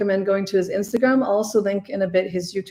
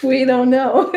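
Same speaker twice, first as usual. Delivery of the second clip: higher, louder, through real laughter; laughing; 6 semitones higher, 4 dB louder, slower than usual. Real, not acted. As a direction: tease them while laughing. Teasing the viewers, laughing.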